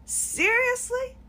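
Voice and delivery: high, slow drawn-out "Seriously"; slow exasperated disbelief. Real, not acted. Venting to the camera, slow incredulous disbelief. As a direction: let it hang, utterly fed up.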